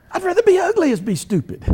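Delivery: very high, loud mock-fuming punchline; a high, hammed-up punchline in the friend's voice; imitating angry friend. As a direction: screech the line like an exasperated buddy. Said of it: "He was really mad".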